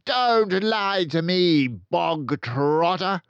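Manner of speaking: loud, high, slow bark. Acted. Headmistress snapping at the accused boy, loud bark. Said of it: "barked the Trunchbull".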